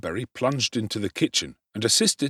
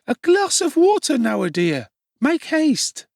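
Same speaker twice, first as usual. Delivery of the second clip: urgent Charlotte; 5 dB louder than usual. Acted.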